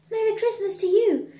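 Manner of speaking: high, loud, quick greeting; cheerful mother. Acted. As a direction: return the wishes with delight. Boy's mother returns greeting warmly.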